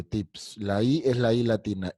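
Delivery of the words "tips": tips with a soft dental Spanish T; soft Latin T. Shown as a demonstration.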